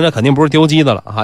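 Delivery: plain reading voice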